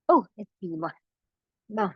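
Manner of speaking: usual speaking voice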